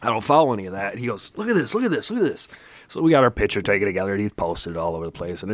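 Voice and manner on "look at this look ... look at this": excited. Retold or imitated. Friend eagerly shows social media.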